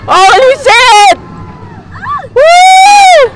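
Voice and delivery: shrieks and yelled warnings; full-voiced panicky yelling; real ride screaming. Real, not acted.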